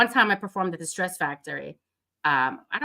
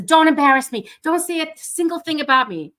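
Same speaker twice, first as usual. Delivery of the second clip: loud, high mock admonishment; stern warning; 10 semitones higher, 8 dB louder than usual. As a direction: warn her emphatically, playing the worried attorney. Acts attorney warning her before show.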